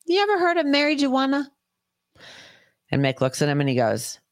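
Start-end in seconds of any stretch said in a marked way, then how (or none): 0.0-1.5 much higher, put-on doctor voice; doctor's sly question. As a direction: imitate the doctor's exact teasing question. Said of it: "He said it just like that".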